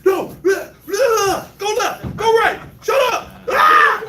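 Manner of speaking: high, loud yelling at the game; hyped gamer bro. Acted. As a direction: scream commands at the screen. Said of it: "Use that game voice".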